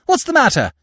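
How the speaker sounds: high, alarmed questions. Acted. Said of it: "Madame Dougreval gasped in dismay".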